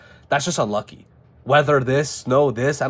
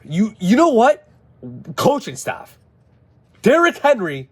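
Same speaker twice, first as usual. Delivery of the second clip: frustrated burst; 7 semitones higher, 3 dB louder than usual. Real, not acted. Frustrated fan blaming the coaching staff.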